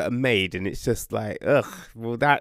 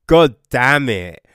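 loud burst of shock; shocked exclamation; 2 semitones higher, 10 dB louder than usual. Real, not acted. Reviewer reacting to a shocking book line, loud exclamation. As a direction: blurt it out, stunned.

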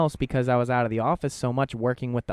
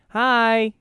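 high, loud sing-song hi; imitating the manager's high bright hello to his son; sweet greeting to a child; 13 semitones higher, 10 dB louder than usual.

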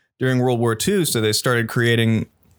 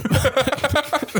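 embarrassed high giggle; high laugh. Real, not acted. Laughing at a roast.

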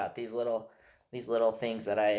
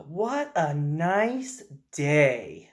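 put-on dreamy little boy; 3 semitones higher, much slower than usual. Dad voicing his son enjoying a walk outside, slow and dreamy. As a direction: savour each word like a content little kid. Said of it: "he just kind of sighs to himself and goes".

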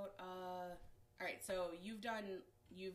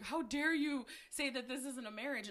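angry; 5 semitones higher, 4 dB louder than usual. Retold or imitated. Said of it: "cussing me out".

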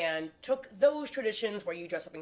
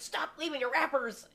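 higher-pitched mock scolding; mock scolding email; 8 semitones higher than usual. Reading out angry pool emails to neighbors, mock scolding. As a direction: act out an exasperated notice.

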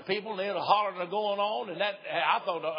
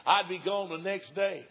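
usual speaking voice

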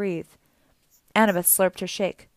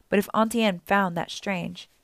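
ordinary delivery, nothing marked

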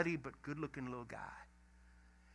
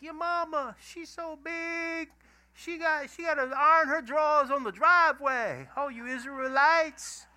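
hammed-up schoolyard mama insults, pitched way up; playground taunting; 11 semitones higher, 8 dB louder than usual. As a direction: jeer loudly in a high put-on voice. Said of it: "the champion of the Philistines is taunting".

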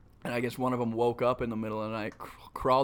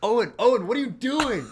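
high, louder retold cry of alarm; alarmed roommate; 10 semitones higher, 5 dB louder than usual. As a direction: act out a roommate catching someone in the act. Voices startled roommate catching him.

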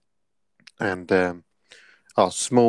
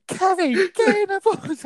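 much higher, slow mocking coach's order; mock coach shout. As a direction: parody the stubborn coach overruling the player. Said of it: "He goes".

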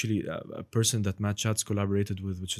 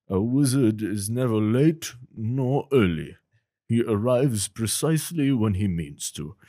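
slow, grave wizard voice, louder; Gandalf; 5 dB louder, slower than usual. Shown as a demonstration. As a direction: pronounce it with slow wizardly gravity. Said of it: "a bit of Gandalf".

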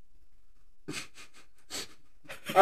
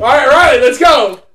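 loud call to get going; rallying shout; 3 semitones higher, 8 dB louder than usual. Real, not acted. Host calling Riley back to focus, loud shout. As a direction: shout them back on task.

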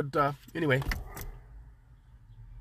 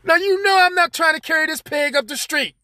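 higher, indignant complaint; protest, pitched up high; exasperated; 8 semitones higher than usual. Retold or imitated. Exasperated about carrying pig.

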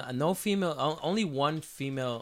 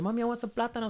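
put-on high little kid voice; little kid asking; 10 semitones higher, faster than usual. Child running to mom, asking in a high little voice. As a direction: beg mommy like a small child, pitched up. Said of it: "And you come run and say".

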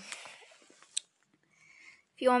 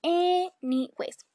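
slow, higher, syllable by syllable; exaggerated segue; 6 semitones higher, 3 dB louder, much slower than usual. Real, not acted. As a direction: draw each syllable out to reset.

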